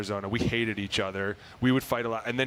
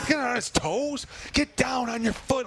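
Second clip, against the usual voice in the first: yelling coach at the TV; 12 semitones higher, slower than usual. Retold or imitated. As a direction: bark it like a coach fired up on the sidelines. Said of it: "and you're like".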